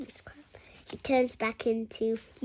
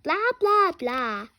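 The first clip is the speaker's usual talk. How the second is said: louder, higher mock blah; 4 semitones higher, 6 dB louder than usual. Child mocking the grumpy principal, a bit louder. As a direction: tease him with a singsong blah.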